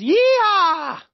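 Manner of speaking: high, loud cowboy cheer; excited whoop. Real, not acted. Teacher cheering the end of lessons, joyful whoop. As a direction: whoop in celebration. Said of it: "It's the end of our lessons".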